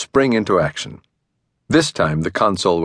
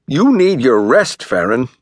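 near tears; 4 semitones higher, 3 dB louder than usual. A narrator's reading. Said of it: "about an inch away from breaking down".

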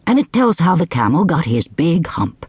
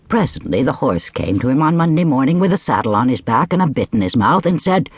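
ordinary delivery

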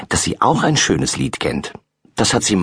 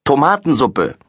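calling out; 7 semitones higher, 3 dB louder than usual. A narrator's reading. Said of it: "ruft Laura".

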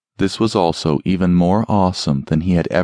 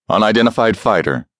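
commanding voice from a speaker; deep booming voice; 2 semitones higher than usual. Acted. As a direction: boom it out like an enemy commander. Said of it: "a deep voice boomed out of the speaker".

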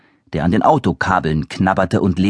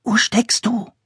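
whispering; 14 semitones higher, slower than usual. Acted. Boy searching at night.

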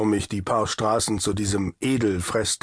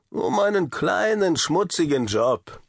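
sleazy newspaperman; 7 semitones higher than usual. A narrator's reading. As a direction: lean in with a sly offer. Siegmann offers dirty job.

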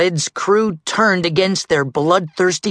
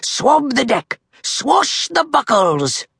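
pirate captain's cry; 12 semitones higher than usual. A narrator's reading. Captain Red setting the crew to work, crying out. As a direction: bawl orders across the deck. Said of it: "cried Red".

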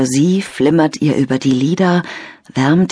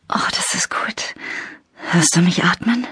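whisper; 4 dB quieter than usual. Acted. Lena whispers into phone.

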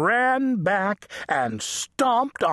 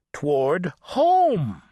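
ordinary delivery, nothing marked

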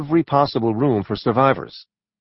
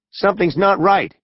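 screaming; 7 semitones higher than usual. A narrator's reading. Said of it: "he screamed".

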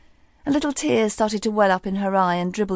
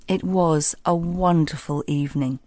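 ordinary delivery, nothing marked

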